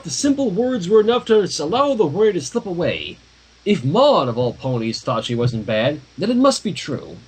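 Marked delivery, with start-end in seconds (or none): none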